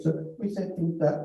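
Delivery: usual speaking voice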